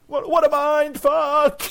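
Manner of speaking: high, slow, loud disbelieving cry; stunned exclamation. Retold or imitated. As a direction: wail it out in shock.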